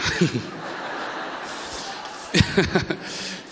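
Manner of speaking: drawn-out, breathy chuckle; real chuckle. Real, not acted. Preacher amused by his own story to the congregation, chuckling. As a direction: laugh at your own joke.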